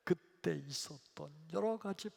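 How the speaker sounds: ordinary talk, nothing marked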